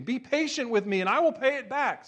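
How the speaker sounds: a touch of desperate pleading; pleading servant. Acted. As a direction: beg him for time. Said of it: "begged him".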